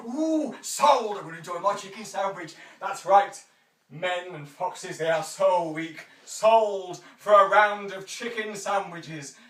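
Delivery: loud, slow, grand closing of the sale; hammed-up auctioneer's final call; auctioneer sells it off. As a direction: bang the gavel with a flourish.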